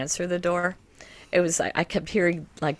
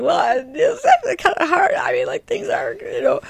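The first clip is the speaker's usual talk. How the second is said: laughing through tears, high and breaking; choked up, half laughing; higher, louder, crying-laughing voice; tearful; 11 semitones higher, 5 dB louder than usual. Retold or imitated. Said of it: "starting to cry a little bit".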